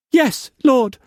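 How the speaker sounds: very high, small whimpered answer; whimpering. A narrator's reading. Mei Mei answering Struan about the child, whimper. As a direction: whimper it fearfully.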